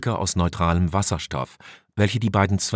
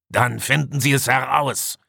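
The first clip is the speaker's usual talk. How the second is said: higher, irritated order; agitated shout; 6 semitones higher than usual. A narrator's reading. Commander orders the engineer to find out, agitated. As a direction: snap the order, mildly worked up. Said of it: "rief Deckon aufgebracht".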